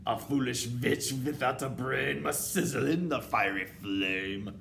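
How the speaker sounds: loud, menacing witch chant; ominous witch verdict; terrible recital. A narrator's reading. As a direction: intone the death sentence loudly. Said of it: "continued with her terrible recital".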